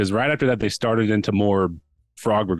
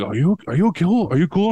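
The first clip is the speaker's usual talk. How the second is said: worried bystanders; 7 semitones higher, 3 dB louder than usual. Retold or imitated. Everyone asking after him.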